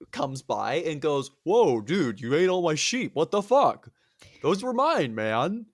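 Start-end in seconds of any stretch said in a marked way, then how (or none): 1.4-5.7 loud, pitched-up aggrieved dude; dismayed protest. Retold or imitated. Cyclops finding his sheep eaten, comic indignation. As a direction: protest like a wronged stoner.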